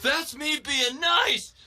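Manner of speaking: high-pitched, strained acted yell. Boy smashing a classmate's microscope, yelling. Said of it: "while yelling".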